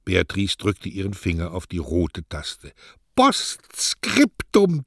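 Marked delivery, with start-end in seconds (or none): 3.2-4.8 high, slow, drawn-out joyful cry; high, stretched, exultant Postskriptum; jubilant. Acted. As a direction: sing the word out in triumph. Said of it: "tanzte der Junge".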